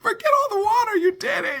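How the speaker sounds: very high, bright congratulation; gushing praise. Retold or imitated. Wife praising husband for the repair, gushing praise. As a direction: cheer him on like a fan. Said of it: "I'm like".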